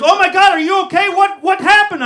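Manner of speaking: high, loud and fast; alarmed girlfriend. Retold or imitated. Girlfriend running into the room, alarmed. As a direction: rush in panicked.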